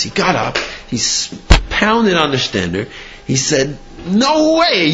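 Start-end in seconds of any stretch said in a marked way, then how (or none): 4.1-4.9 a rabbi bursting out in fury, voice shooting up; furious rabbi. Retold or imitated. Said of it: "He was furious".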